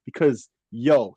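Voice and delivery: normal talking voice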